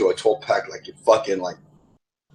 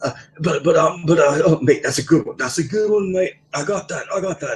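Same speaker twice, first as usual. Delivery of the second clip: put-on high, stammering English accent; flustered put-on English accent; 8 semitones higher, 3 dB louder than usual. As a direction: imitate an excitable English-accented coworker, pitched well up. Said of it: "So he acquired an English accent".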